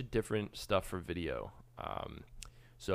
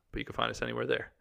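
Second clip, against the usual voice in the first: announcer read; 4 semitones higher, 7 dB louder than usual. Acted. Outro promo read.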